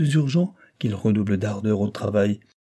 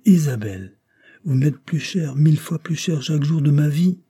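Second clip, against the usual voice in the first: louder, higher outpouring of love; husband pouring out his love to his wife, louder; fervent declaration; 6 semitones higher, 5 dB louder than usual. A narrator's reading. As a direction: swell into a fervent vow. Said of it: "il soupira".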